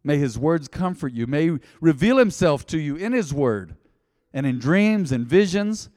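spoken blessing, swelling to full force; benediction. Real, not acted. Pastor blesses the congregation at close, forceful benediction.

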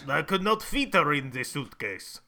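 put-on deadpan Russian agent accent; mock Russian spy accent. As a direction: complain in a heavy Russian accent. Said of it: "honestly pretty good accents".

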